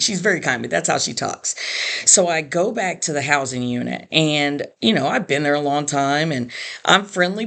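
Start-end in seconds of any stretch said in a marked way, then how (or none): none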